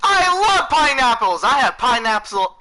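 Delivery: high, loud joking cheer; over-the-top enthusiasm. Pretend. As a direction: yell it like a huge pineapple fan. Mock-enthusiastic answer to the pineapple question.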